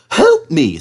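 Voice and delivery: high, loud indignant engine; cross. Acted. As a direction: snap it back, offended. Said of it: "said Thomas crossly".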